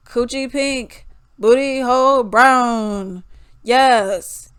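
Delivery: slow, loud rap parody; deep-voiced woman rapper. Imitating the rapper's song, slow deep-voiced delivery. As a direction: drag the lyrics out, loud and heavy. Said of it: "You got a very deep voice".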